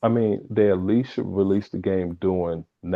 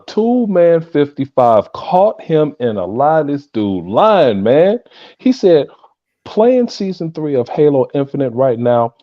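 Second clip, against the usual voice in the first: mock outrage; 9 semitones higher, 4 dB louder than usual. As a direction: call out the liar, voice climbing. Mock outrage at a viewer's lie.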